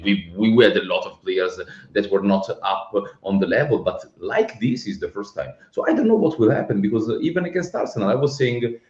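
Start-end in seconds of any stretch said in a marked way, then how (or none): none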